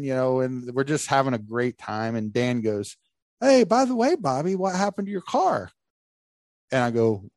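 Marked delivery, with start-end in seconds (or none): 3.4-5.7 high mock buddy voice; imitating friend's high casual question; voicing Dan. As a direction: play Dan casually asking. Dan voiced in retold story.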